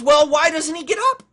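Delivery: put-on high, loud little boy asking. Little boy calling to the old man, high and loud. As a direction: pitch up and call it out like a curious kid. Boy voiced in told story.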